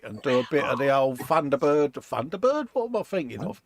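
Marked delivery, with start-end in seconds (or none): 1.9-3.6 higher, rising double take; puzzled self-check. Real, not acted. Host catching his own slip mid-sentence, puzzled rising double take. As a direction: question your own slip.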